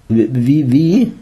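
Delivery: startled stammering, pitch rising at the end; bewildered stammer. A narrator's reading. Jesus torn out of meditation, bewildered stammer. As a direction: stumble over the word, confused. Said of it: "stammelte unser Held verstört".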